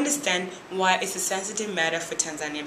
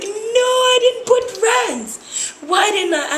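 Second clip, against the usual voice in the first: much higher, louder, very slow mock panic; retold panic; 13 semitones higher, 7 dB louder, much slower than usual. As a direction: stretch out the dismay in a high cry. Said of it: "I was like".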